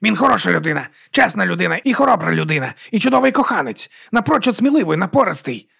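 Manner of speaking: heated, high-pitched sticking up for him; indignant defence. A narrator's reading. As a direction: pile up the praise, climbing in pitch. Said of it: "гаркнула Віга".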